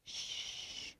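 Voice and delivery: a breathy shushing hiss; hush. Acted. Ivy hushing her brother by Mama's door, softly. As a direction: hush everyone like a conspirator. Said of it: "held her finger to her lips and went".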